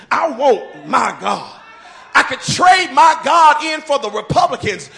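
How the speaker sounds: loud preaching climax, near-chanted; full-force preaching. Acted. Pastor declaring to the congregation he wants his God, shouting. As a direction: build and hammer "I want my God".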